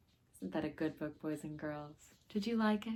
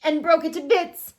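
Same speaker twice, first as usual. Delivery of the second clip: excited; 10 semitones higher, 9 dB louder than usual. A narrator's reading. Excited story reading.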